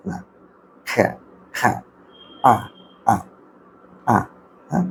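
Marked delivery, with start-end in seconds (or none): 0.9-1.7 খ্যা as a short isolated k sound; phonic sound of C. Shown as a demonstration. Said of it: "কিন্তু উচ্চারণটা কিন্তু". 2.5-4.2 আ said alone as a short vowel; isolated vowel sound. Shown as a demonstration. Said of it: "sound কিন্তু তিনটা".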